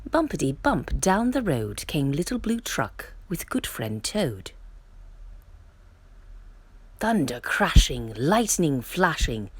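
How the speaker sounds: usual speaking voice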